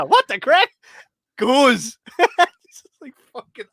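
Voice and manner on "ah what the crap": high, loud startled cry; mock startled yelp. Cop hit by coffee, hammed-up startled cry. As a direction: yelp in shock. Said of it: "The guy's like".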